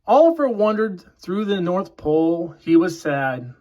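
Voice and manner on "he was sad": ordinary delivery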